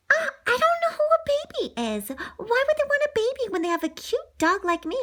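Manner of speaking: high, puzzled and jealous; puzzled puppy. Pretend. Lady the dog jealous of the baby, high voice. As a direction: wonder aloud, a bit put out.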